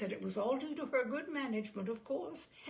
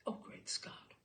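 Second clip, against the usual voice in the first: exhausted breathy groan, softer; groaned whisper; 7 dB quieter than usual. A narrator's reading. Father giving up on mother's accounts, groaning. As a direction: sigh it out, defeated. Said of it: "Father groaned".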